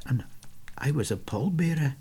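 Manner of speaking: quietly. Acted. Steve adds that he was a pallbearer, quietly. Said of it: "Then he added quietly".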